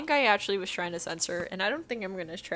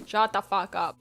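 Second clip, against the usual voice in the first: full voice, louder and emphatic; exasperated outburst; 5 dB louder than usual. Acted. Reader telling Edward off, louder exasperated outburst. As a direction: punch it out in exasperation. Repeats reaction out loud.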